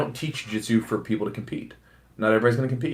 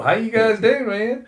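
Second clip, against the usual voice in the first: high, loud hammed-up Southern yokel; slack-jaw yokel accent; 13 semitones higher, 7 dB louder, slower than usual. Mocking his old recorded voice, high Southern yokel. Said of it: "I sound like a".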